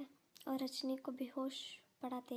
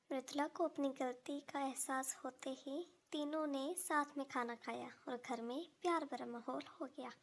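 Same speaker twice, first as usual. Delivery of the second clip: ordinary delivery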